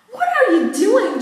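high, loud startled yell; shocked shout. Acted. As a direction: shriek it at the intruder. Sister opens bathroom door.

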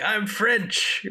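a Monty Python Frenchman taunting; hammed-up French accent; mock French accent. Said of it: "this outrageous accent".

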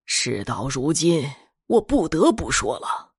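solemn patriarch confessing a secret; grave old master. Acted. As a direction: reveal the family curse gravely. Old master confesses family pact.

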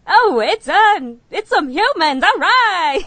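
loud, high forties mid-Atlantic voice; mid-Atlantic accent. Acted. Wishing Barry talked like the forties, mock mid-Atlantic accent. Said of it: "like, a mid-Atlantic, you know, like, forties".

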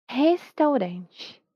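"Restaurante" very slow, H-like R, final "chi"; slow Brazilian "Restaurante"; slow Portuguese accent. Shown as a demonstration. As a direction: stretch "Restaurante" for the learner to copy. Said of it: "en el lugar de la R es una J".

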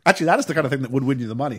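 ordinary delivery

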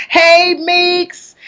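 high-pitched friendly hail; cheery called greeting. Real, not acted. Host hails a friend who tuned in, cheery high greeting. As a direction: sing out a hello to a friend. Calls out to viewer tuning in.

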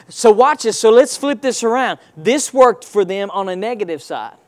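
usual speaking voice

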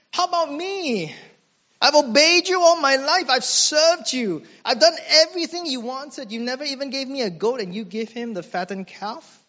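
put-on an older son complaining to his father, high and hurt; aggrieved rant. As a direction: whine out the unfairness of it all. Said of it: "lets out a rant".

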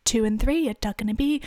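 loud British bingo-caller voice; mock British caller. Caller right in the microphone, loud British accent. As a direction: call it loudly in mock British. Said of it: "in the microphone".

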